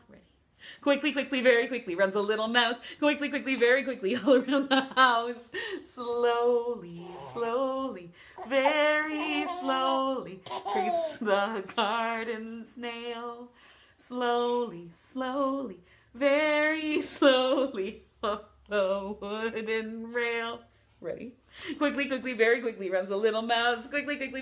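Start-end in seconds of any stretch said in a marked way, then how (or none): 0.8-5.5 rapid, rhythmic scurrying patter; fast playful nursery-rhyme chant; quick rhyme. Pretend. Performing a quick mouse rhyme. 5.8-20.6 long drawn-out vowels; slow, singsong nursery-rhyme crawl. Pretend. Performing a slow snail rhyme.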